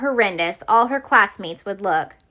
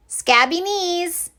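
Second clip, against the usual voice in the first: slow high monster drawl; 8 semitones higher, slower than usual. Acted. Monster posing for photo.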